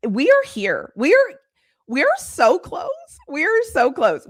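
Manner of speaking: can barely contain it; excited. Real, not acted. To viewers about football camp, real giddy excitement. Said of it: "I might lose my crap".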